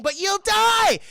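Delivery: high, loud urgent cry; urgent shout. Retold or imitated. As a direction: warn her at full voice. Tony ordering Pepper.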